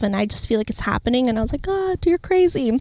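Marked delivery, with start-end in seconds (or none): 1.6-2.8 marvelling. Retold or imitated. Marvelling to God.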